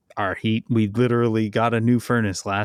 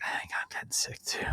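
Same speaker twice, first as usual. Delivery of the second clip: whispering; 12 dB quieter than usual. Retold or imitated. Both parents feeling ill, quoted.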